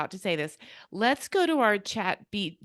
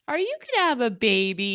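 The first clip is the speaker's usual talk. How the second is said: put-on higher, louder eager child asking; curious kid voice; 6 semitones higher, 6 dB louder than usual. Lightly voiced kid asking teacher about baby, eager. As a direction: play the wide-eyed little kid.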